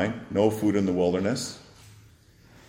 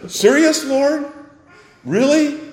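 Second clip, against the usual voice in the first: much higher, loud incredulous cry; rude disbelief; 6 dB louder than usual. Retold or imitated. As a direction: scoff at the idea, exasperated. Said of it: "response of the disciples is quite rude".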